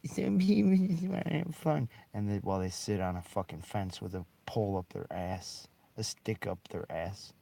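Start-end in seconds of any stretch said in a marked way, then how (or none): 0.1-1.9 whiny voice. Retold or imitated. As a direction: whine it like a sulky cop. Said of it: "they're like".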